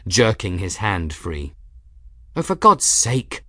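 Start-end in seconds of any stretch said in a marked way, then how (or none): 2.4-3.4 exasperated sigh. A narrator's reading. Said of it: "Gwen sighed".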